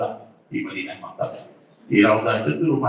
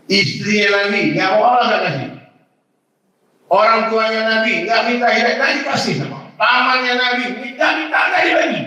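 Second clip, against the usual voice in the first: climbing higher and louder with each line; rising sermon crescendo, far higher by the end; building preaching fervor; 9 semitones higher, 5 dB louder than usual. Real, not acted. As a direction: preach it up to a fever pitch, much higher and louder.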